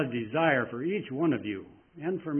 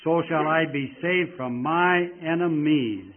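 slow, much louder, heartfelt proclamation; verse read with feeling; 5 semitones higher, 7 dB louder, slower than usual. Shown as a demonstration. Preacher urging feeling into the verses, loud and slow. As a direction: lift the praise line with conviction. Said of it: "take feeling into these verses".

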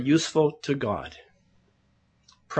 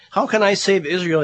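louder, higher protest of self-doubt; Gideon; 5 semitones higher, 5 dB louder than usual. Acted. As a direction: protest the task emphatically, in raised voice. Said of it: "Now Gideon's saying this".

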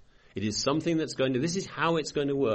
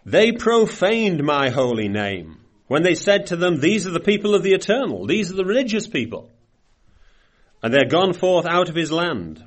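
scripture reading; 4 semitones higher, 5 dB louder than usual. Acted. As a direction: let each phrase of the verse land. Reads scripture aloud.

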